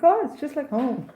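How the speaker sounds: high, loud, laughing exclamation; retold burst of laughing delight. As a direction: exclaim it gleefully through laughter. Delighted retold reply, laughing around it.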